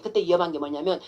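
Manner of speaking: usual speaking voice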